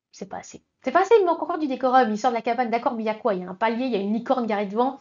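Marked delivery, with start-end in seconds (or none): 0.1-0.6 breathy unvoiced C'est pas assez; half-whispered verdict. Real, not acted. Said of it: "critic's verdict". 0.8-2.1 louder, higher and rushed; fast emphatic verdict. Real, not acted. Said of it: "critic's verdict".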